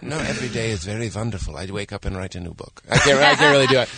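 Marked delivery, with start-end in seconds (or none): 0.0-2.8 put-on Indian accent. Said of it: "with a W, so it's a V".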